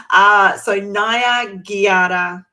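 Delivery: slow teacher demo; slow demo of Naya Giara. Shown as a demonstration. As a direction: stretch Naya Giara out for learners. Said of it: "it sounds a bit interesting".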